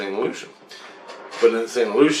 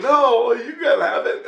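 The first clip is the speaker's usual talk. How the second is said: laughing high voice; 12 semitones higher than usual. Retold or imitated. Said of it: "And I'm like".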